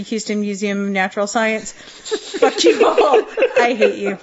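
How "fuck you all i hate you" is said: drawn-out cursing broken up by real laughter; helpless laughter. Real, not acted. Cursing co-hosts who derailed the museum plug, laughing. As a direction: laugh through the mock hostility.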